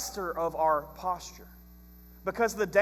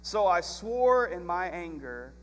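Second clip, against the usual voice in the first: scripture reading. Acted. Reads scripture aloud.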